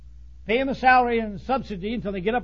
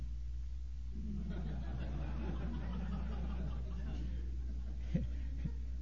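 long breathy silent laugh; quiet wheezing laugh; 19 dB quieter than usual. Real, not acted. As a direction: laugh almost without voice. Long laugh after joke.

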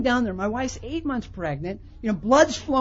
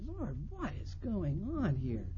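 hushed, slow, low plea to God; quiet, drawn-out bewilderment; stunned prayer; 5 semitones lower, 14 dB quieter than usual. Retold or imitated. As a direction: ask it softly, dazed. Stunned prayer after the beating.

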